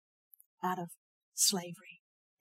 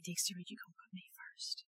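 soft, breathy whisper of God's question; hushed divine whisper; 12 dB quieter than usual. Retold or imitated. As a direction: whisper it intimately, like a still small voice. God's voice speaks to her.

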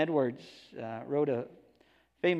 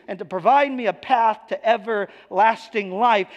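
higher and louder, building into a fervent pulpit cry; full-force preaching; 7 semitones higher, 5 dB louder than usual. Acted. Preacher to his congregation about the gospel, impassioned and loud. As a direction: preach it with rising fire.

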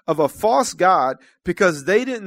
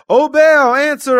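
high, loud cry to Baal; high, loud desperate plea; shouting prophets; 7 semitones higher, 9 dB louder, slower than usual. Acted. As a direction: cry out to your god.

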